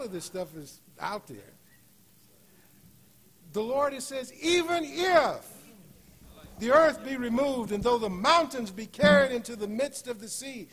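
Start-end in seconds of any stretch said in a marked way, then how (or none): 3.5-10.7 loud, high, building sermon cadence; preacher crying out the psalm's promise; full-force preaching. Acted.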